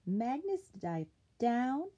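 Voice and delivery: plain reading voice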